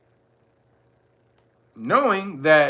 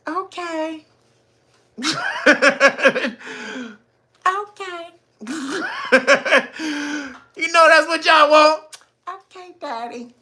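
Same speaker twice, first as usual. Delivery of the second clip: coy woman voice; 8 semitones higher than usual. Acted. Acted woman giving in.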